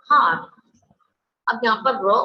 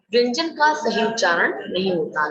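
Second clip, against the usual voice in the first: usual speaking voice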